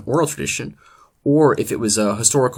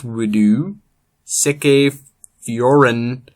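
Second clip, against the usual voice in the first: loud, slow, booming epic recitation; slow thundering saga in Old English; dramatic Old English reading; 5 dB louder, much slower than usual. Acted. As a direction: declaim it like an ancient bard. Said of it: "I present to you now a historical, uh, reenactment of Beowulf".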